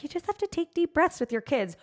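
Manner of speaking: high mock-preachy lecture; sing-song sanctimonious parent, mocking. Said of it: "be preachy".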